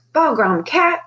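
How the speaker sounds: high, startled bark at the cat. A narrator's reading. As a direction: bark out a scolding. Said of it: "Deli barked".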